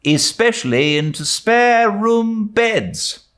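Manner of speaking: put-on high, chuckling old neighbour voice; pleased with her own little joke; Mrs Lynde, amused. A narrator's reading. Mrs Lynde joking to Anne, comfortably amused. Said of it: "Missus Lynde laughed comfortably".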